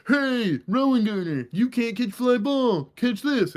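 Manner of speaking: high mocking kid voice, slowed; taunting kid. A kid taunting the boy who dropped the ball, high and mocking. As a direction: jeer like a schoolyard bully. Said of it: "some kid's like".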